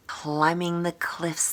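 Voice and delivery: ordinary delivery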